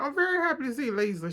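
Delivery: a hint of fake-polite Mary, rattled off; mock Mary. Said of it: "She went".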